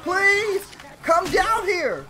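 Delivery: high, loud begging yells; pleading shout. Real, not acted. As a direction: beg them to come down, loud. Yelling at teammates to follow.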